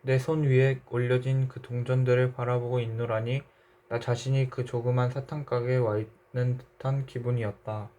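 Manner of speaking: ordinary delivery, nothing marked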